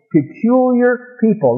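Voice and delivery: peculiar lifted high and slow; emphatic peculiar. Acted. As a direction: lift the word peculiar up high.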